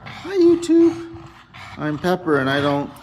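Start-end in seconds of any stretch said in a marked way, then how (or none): 0.0-1.1 lightly voiced dog, slow start; dog's voice. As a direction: speak for the pet, a little higher. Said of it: "You're gonna say hi to YouTube?".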